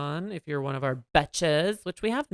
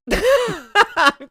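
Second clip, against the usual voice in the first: laughing; 11 semitones higher, 8 dB louder, much slower than usual. Real, not acted. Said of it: "I'm kidding".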